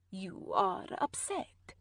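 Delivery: low, slow, hushed command; stern Irene. A narrator's reading. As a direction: urge her quietly and firmly.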